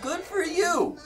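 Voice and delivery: high-pitched, drawn-out echo; mock congratulation. Gamer parroting the co-player's remark, high mocking echo. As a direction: throw it back in a silly high voice.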